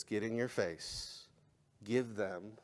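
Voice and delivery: ordinary talk, nothing marked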